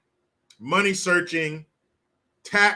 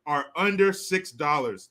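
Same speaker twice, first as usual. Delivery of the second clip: normal talking voice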